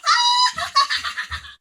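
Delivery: very high, loud shrieking laugh; princess laughing. Acted. Princess laughs after throwing crown into sea, shrieking. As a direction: cackle with glee. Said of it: "she started to laugh".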